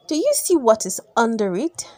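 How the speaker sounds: ordinary delivery, nothing marked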